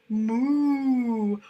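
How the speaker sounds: loud drawn-out moo; cow moo. Acted. Teacher voicing Mister Cow, a loud long moo.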